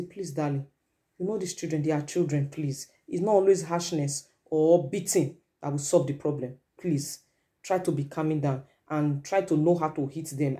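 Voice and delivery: very soft, low pleading; hushed, low, tender acted appeal; calming the husband. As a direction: soothe an angry husband gently. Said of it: "Try to calm your husband down".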